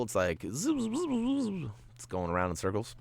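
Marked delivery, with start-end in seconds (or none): 0.5-1.7 zooming noise. Shown as a demonstration. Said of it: "imitating the purple trail".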